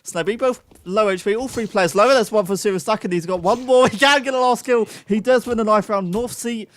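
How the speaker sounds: high, loud esports caster; excited play-by-play. Real, not acted. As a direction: call the clutch finish at full pitch. Live play-by-play at a climax.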